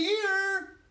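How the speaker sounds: very high, loud, stretched call; insistent shout. A narrator's reading. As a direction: belt it out, long and high.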